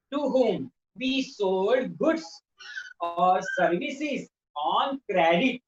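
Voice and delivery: very slow, word by word definition; slow dictation. Shown as a demonstration. Teacher dictating a definition to the class, very slow. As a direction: dictate it slowly for students to note down. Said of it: "मैं definition कहता हूँ".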